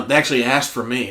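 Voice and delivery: usual speaking voice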